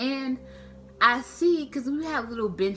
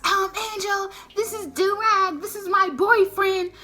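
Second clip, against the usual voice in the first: put-on friend introducing boyfriend; 6 semitones higher, 4 dB louder than usual.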